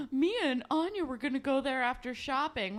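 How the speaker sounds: a lightly hammed-up worried guy; worried boyfriend voice. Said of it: "he's like".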